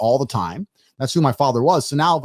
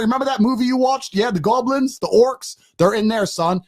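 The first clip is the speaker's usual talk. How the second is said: mock stern dad; 9 semitones higher than usual. Father sends scared son into dark room, mock stern. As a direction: act out a tough father daring his boy. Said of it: "I'm gonna say".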